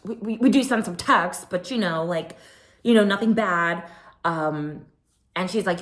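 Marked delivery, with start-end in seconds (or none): 0.0-3.9 mock flustered stammer, pitched higher; imitating someone tripping over words; stuttering. Mocking Kyle caught out about texting, stammering. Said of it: "she's stuttering, can't get the words out".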